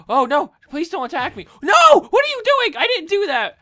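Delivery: high, loud, frantic denial; panicked protest. Real, not acted. Gamer begging guards not to attack him. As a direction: plead innocence in a panic.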